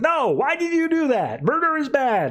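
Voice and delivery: very high mock outcry; hammed-up scolding, voice leaping high; appalled protest. Superman appalled at the killing.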